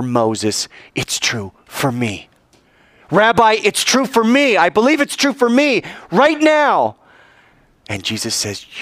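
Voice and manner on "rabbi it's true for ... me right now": high, loud joyful conviction; fervent declaration. Acted. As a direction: proclaim it with burning faith. The woman declares her faith.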